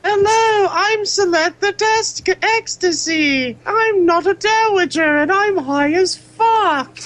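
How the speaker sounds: unicorn voice. Pretend. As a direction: play a dopey, stoned unicorn. Playing the unicorn character.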